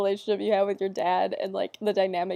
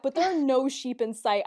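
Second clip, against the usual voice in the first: normal talking voice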